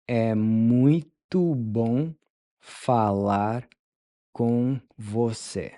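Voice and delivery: "É muito bom" slowed into chunks with pauses; slow broken-up model. Shown as a demonstration. Teacher modeling the phrase slowly in chunks for learners. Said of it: "How do you tell someone".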